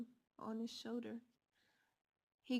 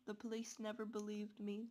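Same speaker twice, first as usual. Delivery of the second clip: ordinary delivery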